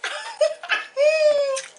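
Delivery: high-pitched helpless laugh; squealing laugh. Real, not acted. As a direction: laugh until it turns into a squeal. Laughs at her rat joke.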